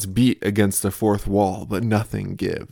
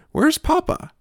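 put-on much higher, louder small-child question; toddler voice; 5 dB louder than usual. Toddler asking where Papa went, high child voice. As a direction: ask it like a toddler looking for Papa.

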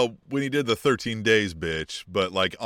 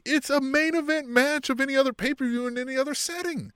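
excited; 13 semitones higher than usual. Real, not acted. Excited emphasis on match.

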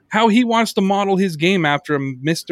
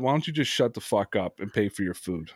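low, soft and threatening; retelling a cold threat to a rude customer; low menacing retort; 7 semitones lower, 9 dB quieter than usual. Retold or imitated. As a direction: mutter it like a quiet threat. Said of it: "I looked at him and I said".

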